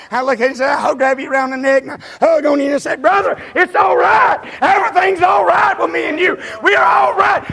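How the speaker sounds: loving preacher bellowing reassurance to a brother; full-force preaching. Acted. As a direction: proclaim it with all you've got.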